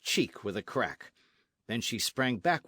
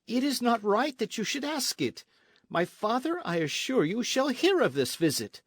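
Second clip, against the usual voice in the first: high-pitched, heated warning; indignant protest; 11 semitones higher, 4 dB louder than usual. A narrator's reading. Señorita threatens captain with her father, indignant.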